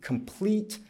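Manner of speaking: "complete" pitched high, with a rise; stress on COMPLETE. Real, not acted. Repeating the key word of a health definition, "complete" stressed high. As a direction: lift "complete" up high to underline it. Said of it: "I underline that word complete".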